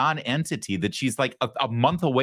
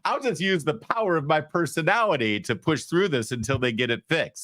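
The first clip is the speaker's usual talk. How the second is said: lightly voiced candidate, pitched up then settling; higher-pitched mock inner monologue; 7 semitones higher than usual. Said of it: "And she's like".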